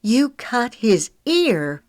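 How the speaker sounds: high, loud and slow disbelief; incredulous. A narrator's reading. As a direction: stretch it out in disbelief. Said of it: "explained incredulously".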